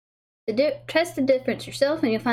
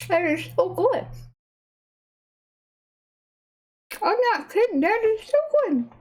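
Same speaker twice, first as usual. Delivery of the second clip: high, thrilled praise; delighted; 9 semitones higher than usual. Real, not acted. Tasting the cake on camera, high delighted gushing. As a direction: gush over the taste.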